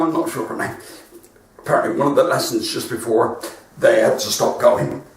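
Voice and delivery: ordinary talk, nothing marked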